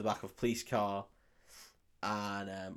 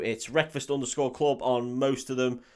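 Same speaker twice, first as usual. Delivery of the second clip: normal talking voice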